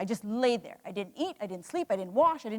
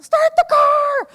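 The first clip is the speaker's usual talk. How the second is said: loud, high, slow mock yell; commercial shout; 13 dB louder, slower than usual. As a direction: belt it like the old TV ad. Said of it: "remember the commercial?".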